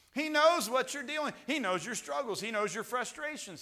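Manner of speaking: loud, high sermon delivery; preaching at full force. Acted. Preacher assuring the church God cares, loud preaching. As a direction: drive it home to the congregation.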